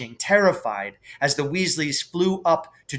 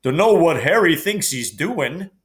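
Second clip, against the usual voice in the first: Hagrid's voice; 3 semitones higher, 4 dB louder than usual. A narrator's reading. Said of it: "Hagrid mumbled".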